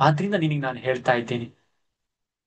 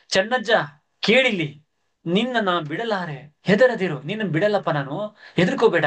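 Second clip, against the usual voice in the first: higher, firm loud reassurance; said loudly; 5 semitones higher than usual. Acted. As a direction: call it out strongly. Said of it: "ಗಟ್ಟಿಯಾಗಿ ಜೋರಾಗಿ ಹೇಳ್ತಾ ಇದ್ದಾನೆ". (ಜೋರಾಗಿ ಧೈರ್ಯ ತುಂಬುವ ದನಿ)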